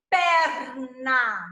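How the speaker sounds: "perna" split into a long "per" and "na"; syllables drawn apart. Shown as a demonstration. Teacher shows the first syllable, "perna" pulled apart as per-na. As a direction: stretch "perna" out as two separate syllables. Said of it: "é com per".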